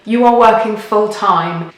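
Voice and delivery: ordinary talk, nothing marked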